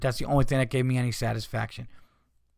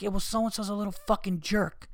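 higher-pitched mock contempt; mock dismissive dad; 6 semitones higher, 4 dB quieter than usual. Dad answering his son about a schoolmate, mock dismissive. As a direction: toss off the insult in an exaggerated retelling. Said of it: "I didn't say it like that".

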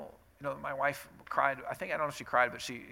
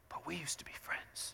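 Iron Man; 3 semitones higher, 12 dB quieter than usual. Retold or imitated. Hero to his old friend Captain America, hurt whisper.

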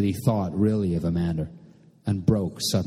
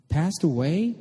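high, questioning rise of panic; panicked question, voice lifting high; sudden panic; 9 semitones higher, slower than usual. A narrator's reading. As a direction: put the phrase together in dawning panic, pitched high. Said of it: "shook with sudden panic".

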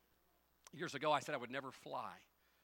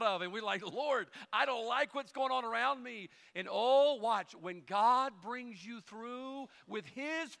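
loud, high, slow preaching climax; preaching at full force; 8 semitones higher, 10 dB louder, slower than usual. Acted. Preacher proclaiming God's preeminence, loud and high. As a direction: shout God's victory over the trial.

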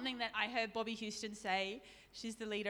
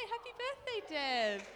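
high, sing-song happy birthday; bright birthday wish; 6 semitones higher, slower than usual. Real, not acted. Greeting Deb from the pulpit on her birthday, high and bright. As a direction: call out happy birthday much higher, beaming.

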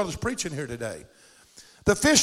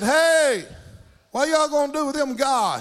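higher-voiced Southern good old boy; real Southern accent; 6 semitones higher, 3 dB louder than usual. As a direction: imitate a Southern stranger calling out. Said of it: "He had a real southern accent".

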